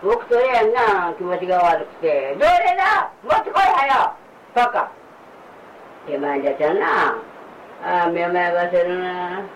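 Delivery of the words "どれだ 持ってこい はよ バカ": angry shout. Real, not acted. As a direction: bark the order in fury. Demanding his medicine. (怒鳴って要求する)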